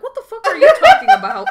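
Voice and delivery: high, loud, laughing disbelief; incredulous outburst. Real, not acted. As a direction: blurt it out loudly through a laugh. Reacting incredulously to retold remark.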